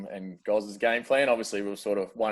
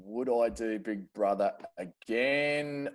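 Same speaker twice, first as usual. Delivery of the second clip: slow, stretched-out musing; drawn out, buying time to think; pondering; 4 dB quieter, much slower than usual. Real, not acted. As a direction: mull the question over as you repeat it. Repeating a fan's question, pondering.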